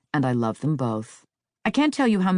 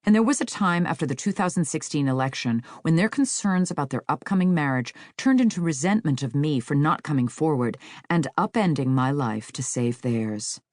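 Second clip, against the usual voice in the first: ordinary delivery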